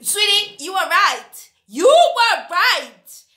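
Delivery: excited admission. Acted. Admitting the commenter was right.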